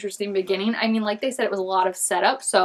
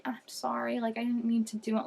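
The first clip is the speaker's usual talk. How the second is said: timid whispered apology; 11 dB quieter than usual. Retold or imitated. Evan apologizing after animals flee.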